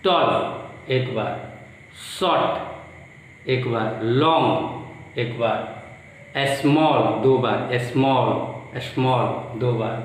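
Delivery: slow, each word said with care to count its syllables; slow, deliberate classroom demo of syllables. Shown as a demonstration. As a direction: draw each word out so the mouth shapes can be counted. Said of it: "मुंह कितना बार बन रहा है".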